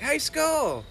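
calling out. Retold or imitated. Promoter shouting to a newcomer, calling out. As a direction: call out to someone far off. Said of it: "he's like".

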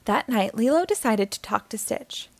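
ordinary delivery

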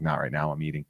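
low, soft brush-off mumble. Retold or imitated. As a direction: mutter it low and dismissive. Celebrity brushing off autograph kids.

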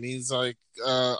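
ordinary talk, nothing marked

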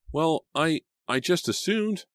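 high, flustered, broken-up start; stammering. A narrator's reading. Girl startled by her friend's anger, stammering. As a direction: falter for words, taken aback. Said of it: "Selina stammered".

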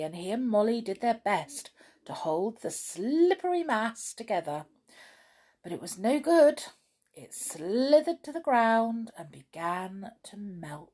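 usual speaking voice

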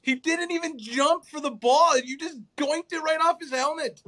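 can't believe he botched it; exasperated pitch jump; incredulous. Real, not acted. Ranting about a bad throw.

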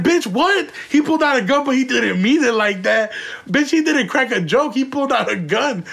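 shrill retold disbelief; incredulous outrage. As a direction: throw her excuse back at her, stunned. Disbelief at her excuse for gun.

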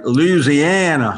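very slow, drawn-out fake Southern accent; hammed-up Southern drawl on "Louisiana". Shown as a demonstration. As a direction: stretch Louisiana like a movie Southerner. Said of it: "when people faked Southern accents".

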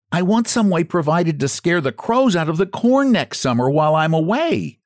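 a hint of a timid scarecrow asking a favour; lightly voiced scarecrow. A narrator's reading. Jimmy voiced in story.

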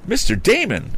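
high-pitched cry of recognition; exclaiming. A narrator's reading. Young inventor recognizes the eccentric gentleman, exclaiming. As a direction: call out the name high, in surprise. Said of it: "exclaimed Tom".

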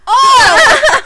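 high, loud laughing shriek; real reaction yelp; startled cry. Real, not acted. Reacting to the show.

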